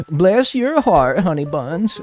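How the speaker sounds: slow, lightly voiced Southern old lady accent; a sweet old Southern lady, slow and high. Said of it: "like, Southern old lady".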